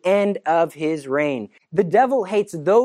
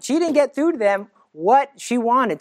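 ordinary delivery

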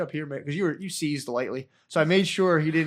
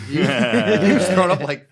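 cracking up mid-sentence, slower and higher; laughing; 5 semitones higher, 8 dB louder than usual. Real, not acted. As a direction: laugh through the words. Laughing while telling the story.

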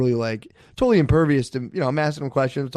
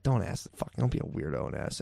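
inner voice; 7 semitones lower, 9 dB quieter than usual. Retold or imitated. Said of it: "literally in my head".

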